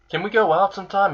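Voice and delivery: a hint of a blushing girl asking for a date; lightly voiced smitten girl. Girl blushing asks him out.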